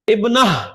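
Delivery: ابنہ stopped on an H; H when pausing. Shown as a demonstration. Teacher explaining pausing on a word, ابنہ with final H. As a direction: come to rest on H at the end of ابنہ. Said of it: "رک رہے ہیں".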